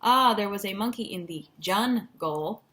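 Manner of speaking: mock sentence with JUNGLE chopped into jun, gol; hammed-up example, JUNGLE in two stiff chunks; wrongly split word. Shown as a demonstration. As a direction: read it out, then break JUNGLE unnaturally in two. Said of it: "we don't go".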